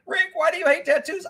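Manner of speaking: very high put-on voice; high mocking falsetto question; mock complainer. Mimics complaining follower.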